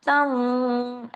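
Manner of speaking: तन ending in a held nasal glide, not a hard n; idgham with ghunna. Shown as a demonstration. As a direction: melt the end of तन into a long hum. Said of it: "ऐसे हो जाएगा". (तन में नून की जगह गुन्ने वाली आवाज)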